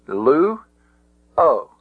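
"lu" and "o" as two separate syllables, long pause between. Shown as a demonstration. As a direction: break the word into "lu" ... "o", each sounded alone. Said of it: "only has two syllables".

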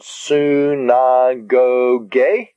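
su, na, go, ge in measured, linked beats; each syllable of su, na, go, ge given equal length. Shown as a demonstration. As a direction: sound out su-na-go-ge evenly. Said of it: "Looking at the word".

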